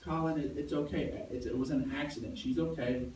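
reassuring. Retold or imitated. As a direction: comfort the child gently. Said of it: "and I'm like".

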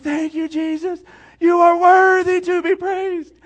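high, loud worship cry; shouted praise. Retold or imitated. Boy repeating his praise in the deer blind, loud and high. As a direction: belt the praise out. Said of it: "that was what he did".

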